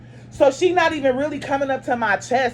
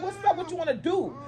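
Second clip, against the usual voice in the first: challenging; 8 semitones higher, 6 dB quieter than usual. Retold or imitated. Acting out squaring up for fight.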